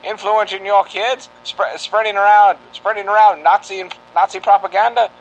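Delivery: put-on old-time radio campaign read; rally the public like a newsreel; 1940s radio announcer. Mock public service campaign.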